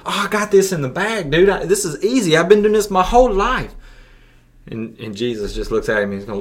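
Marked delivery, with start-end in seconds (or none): 0.1-3.8 put-on higher, eager boast of the rich young ruler; excited young man. Preacher voicing the rich young ruler bragging to Jesus, eager and higher. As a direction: play the young ruler sure he's got it in the bag. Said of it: "And he's all excited".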